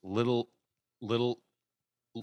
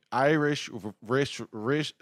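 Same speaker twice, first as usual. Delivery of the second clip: normal talking voice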